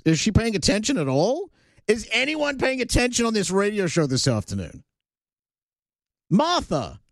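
high-pitched, exasperated questioning; mock exasperation. Real, not acted. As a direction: call out an inattentive colleague. Calling out inattentive producer Martha.

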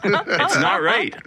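It's not right, said slowly through real laughter; laughing. Real, not acted. Repeats complaint, then laughs.

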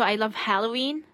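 ordinary talk

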